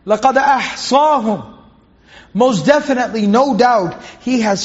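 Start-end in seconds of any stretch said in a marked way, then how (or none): 0.1-1.6 fast, flowing chanted recitation of the verse; Quran recitation. Acted. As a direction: recite it with tajweed. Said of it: "Allah subhanahu wa ta'ala says".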